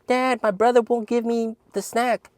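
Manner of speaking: put-on high, childish tattling voice; whiny little brother. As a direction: mimic a little kid telling on his brother. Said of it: "He'd be like".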